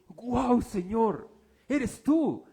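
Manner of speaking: loud, high burst of wonder at God's guidance; amazed praise. Retold or imitated. Preacher reliving the team's amazed prayer, loud and high. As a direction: shout it out like a sudden revelation.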